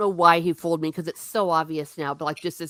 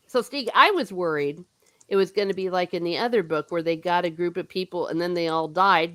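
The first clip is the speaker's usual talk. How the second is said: ordinary talk, nothing marked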